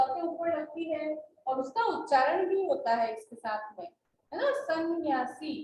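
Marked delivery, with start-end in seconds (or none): 4.6-5.5 सन्यासी slowly divided, "sann-nyaa-see"; correct pronunciation. Shown as a demonstration. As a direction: hold the nasal in सन्यासी. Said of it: "ये शुद्ध होगा".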